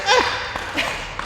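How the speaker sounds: sharp high unvoiced gasp of surprise; surprised gasp. Stunned pastor gasps at the manager's news. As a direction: gasp as the shock lands. Shock at learning of the credit.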